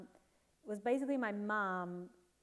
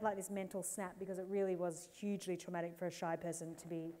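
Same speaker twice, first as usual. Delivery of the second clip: ordinary talk, nothing marked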